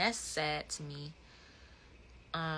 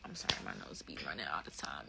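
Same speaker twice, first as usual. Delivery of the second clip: unvoiced, low muttered aside; whisper; 14 semitones lower, 8 dB quieter, faster than usual. Real, not acted. Apologising to viewers for running nose in a whisper. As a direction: let it fall to a whisper.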